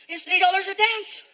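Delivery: put-on high, slow kid voice; matter-of-fact kid. Son telling dad the price, high kid voice. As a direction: explain it simply like a kid.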